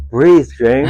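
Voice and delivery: loud, firm "breathe"; firm command. Retold or imitated. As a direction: call it out to snap him back. Retelling trainer's calm command.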